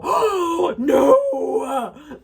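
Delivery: high, loud shocked oh no. Shown as a demonstration. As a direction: react like you overheard a scandal. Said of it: "like that".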